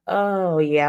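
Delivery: ordinary delivery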